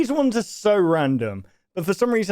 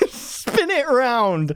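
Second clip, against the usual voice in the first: slow, higher mock echo of the woman; stretched-out, amused imitation; mimicking the clip; 6 semitones higher, much slower than usual. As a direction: draw out the quote in a raised voice. Mimics the line just played.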